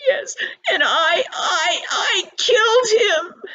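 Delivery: high, crying, halting voice; tearful stammer. Acted. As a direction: stumble over the words through sobs. Boy repeats confession to father.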